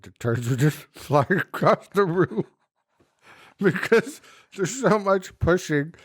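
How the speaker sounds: laughing through the words. Real, not acted. Telling a friend about flying poop, laughing. Laughing while retelling a story.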